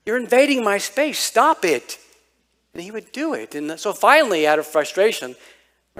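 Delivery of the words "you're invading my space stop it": annoyed big brother. Retold or imitated.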